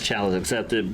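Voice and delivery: ordinary talk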